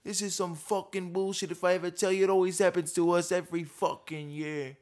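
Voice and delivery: high, loud New York fan outrage; New York accent. Retold or imitated. New York fan losing it over Aaron Rodgers, loud accent. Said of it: "that's my best New York impression".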